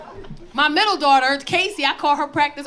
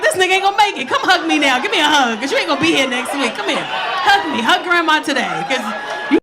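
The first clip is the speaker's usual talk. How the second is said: mock urgent plea; 6 dB louder than usual. Grandma mock-pleads for a hug.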